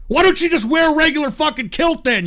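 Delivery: usual speaking voice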